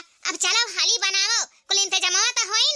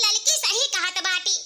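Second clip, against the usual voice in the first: normal talking voice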